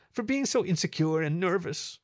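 high, tearful confession; sniffling. A narrator's reading. Meadow explains her apology to Fib, tearful. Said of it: "Meadow sniffled".

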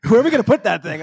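high-pitched mock wifely protest; imitated exasperated wife. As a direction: act out a wife objecting, pitched well up. Retelling wife's objection to huge trophy.

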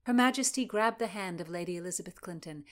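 usual speaking voice

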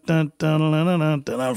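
humming the drum beat with mouth sounds; beatboxing the old loop in rhythm; vocalized beat. Retold or imitated. Producer humming the beat he sampled, sung-along mouth drums. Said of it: "that was the beat".

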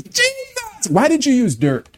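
high, incredulous pleading question; puzzled question to Jesus. Pretend. Believer in heaven asking Jesus, high puzzled question. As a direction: ask it like a bewildered child. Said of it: "The first thing you're gonna say".

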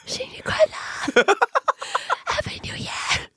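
high, breathy, airless whisper; Rose whisper. Acted. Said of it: "用那个声音说新年快乐可以吗".

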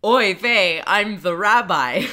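put-on loud, slow Yiddish-accented rabbi. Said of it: "he says".

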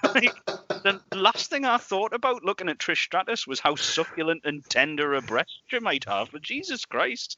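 high, incredulous rant winding down; exasperated disbelief. Real, not acted. Podcaster still ranting about Vince, high and incredulous. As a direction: stay up in outraged disbelief. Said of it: "still ranting in disbelief".

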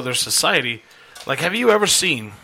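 ordinary delivery

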